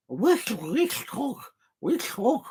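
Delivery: high, badly faked cartoon duck; Daffy Duck. YouTuber trying a cartoon for viewers, badly faked duck voice. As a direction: try a squeaky cartoon duck and miss. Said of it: "I can't do the Daffy Duck accent".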